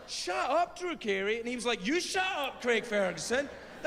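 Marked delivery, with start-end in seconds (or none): 0.1-1.4 high teasing shove; mock outrage, much higher; playful shut up. Playful argument with friend. 1.9-3.5 high teasing shove; mock outrage, much higher; playful shut up. Friend teasing back.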